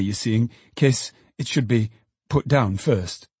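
ordinary delivery

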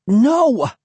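high, loud protest; alarmed cry. A narrator's reading. Narrator as the big dog leaps, alarmed cry. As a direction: cry out as the dog jumps on you. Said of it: "I managed to cry".

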